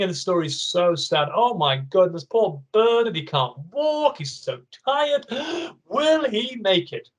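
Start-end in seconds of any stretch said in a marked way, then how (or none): 1.3-5.3 worried exclamation. Acted. Reader gasps for Bernard. 5.3-5.8 dramatic gasp. Acted. Mock gasp. 5.9-7.1 worried exclamation. Acted. Reader gasps for Bernard.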